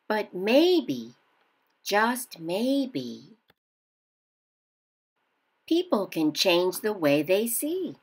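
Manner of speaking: gentle picture-book read-aloud; reading to children. A narrator's reading. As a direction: read it like story time for kids. Teacher reads picture book aloud.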